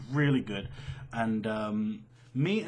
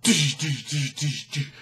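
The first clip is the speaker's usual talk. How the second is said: impact noise; 6 dB louder, slower than usual. Retold or imitated. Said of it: "it's like".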